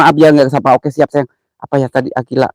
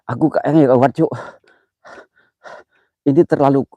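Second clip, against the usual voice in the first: ordinary talk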